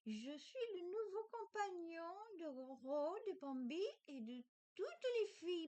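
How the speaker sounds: put-on high, childlike, cheerful plush toy; cute toy voice. The new toy introduces itself to viewers, high and childlike.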